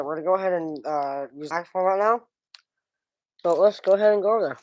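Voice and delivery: talking around a cough drop; sucking a cough drop. Real, not acted. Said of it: "I'm just showing my cough drop right now".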